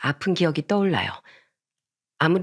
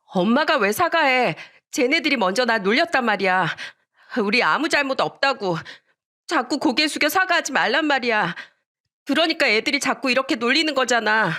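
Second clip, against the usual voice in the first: put-on high, upset kid protesting; higher-pitched indignant whine; angry child's outcry; 6 semitones higher than usual. A narrator's reading. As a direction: protest shrilly to your mother. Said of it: "화가 나서 엄마를 말리면서 소리쳤습니다".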